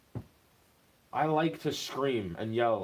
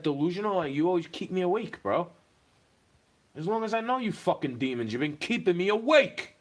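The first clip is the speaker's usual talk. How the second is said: angry rant; 6 semitones higher than usual. Real, not acted. Cursing the noisy neighbours who keep him awake, furious. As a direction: vent hard at the demons next door.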